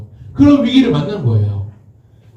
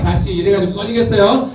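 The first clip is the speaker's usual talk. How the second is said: ordinary talk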